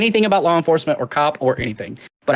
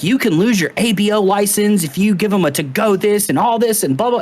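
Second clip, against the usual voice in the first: mocking upset bartender; 6 semitones higher than usual. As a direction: parody her fussy rant. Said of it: "she is, like, upset".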